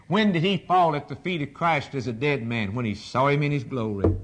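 ordinary delivery

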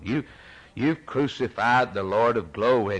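usual speaking voice

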